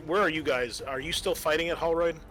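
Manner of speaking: ordinary talk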